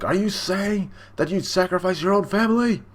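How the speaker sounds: high-pitched theatrical disbelief; retold outraged question; indignant challenge. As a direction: act out an affronted father. Judge challenges his son.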